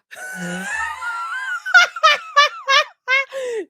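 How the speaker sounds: long, high squealing laugh; drawn-out high wheeze into loud laughter. Real, not acted. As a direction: lose it completely laughing. Cracks up at accent attempt.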